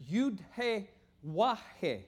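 "Yud He Wah He" slowly, letter name by letter name; Hebrew letter names. Shown as a demonstration. Teacher showing a congregation how Hebrew letters sound, slow demonstration. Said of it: "pronounced in, in, in Hebrew down here".

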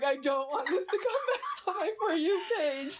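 laughing complaint. Retold or imitated. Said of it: "'cause I was like".

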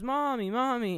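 lightly voiced child calling mommy; little kid calling. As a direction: call out like an eager small boy. Child Andy voiced in retelling.

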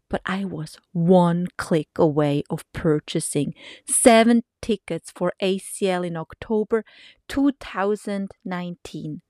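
ordinary delivery

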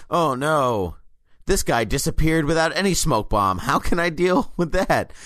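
mock bafflement. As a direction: play the dumbfounded hero for laughs. Mocking the hero's bafflement.

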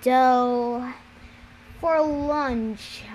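ordinary delivery

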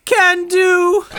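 high, swooning damsel voice; hammy stage actor. Acted. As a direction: overplay a delighted damsel. Damsel greets her knight.

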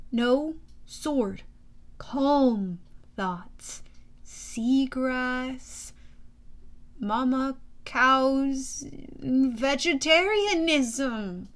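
slow, soothing words to a frightened animal; very slow, calming murmur to a scared calf. Acted. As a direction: lull it down gently, word by word. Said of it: "speaking as calmly as I could".

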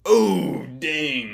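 high loud fake wail; mock dismay. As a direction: overdo a shocked cry of dismay. Mock dismay at non-gamer.

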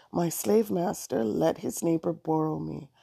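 plain reading voice

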